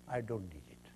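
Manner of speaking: soft and gentle, "I don't need it." said quietly and low. Acted. Buddha gently declining the woman's insult, soft and low. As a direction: let "I don't need it." fall softly, kindly. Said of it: "He gently said".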